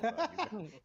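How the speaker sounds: said with a grin; smiling. Real, not acted. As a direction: let the smile come through. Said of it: "I can hear the smile in your voice".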